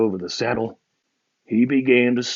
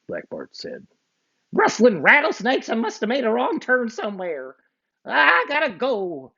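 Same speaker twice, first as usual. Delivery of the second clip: outlaw voice; 11 semitones higher than usual. Acted. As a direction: give up grumbling and leave.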